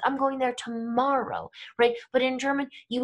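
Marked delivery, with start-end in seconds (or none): 0.0-1.5 "tomorrow" stressed and stretched out. Shown as a demonstration. Teacher correcting the day to show intonation, stress on "tomorrow". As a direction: insist on "tomorrow" as the key word. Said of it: "do it with our intonation".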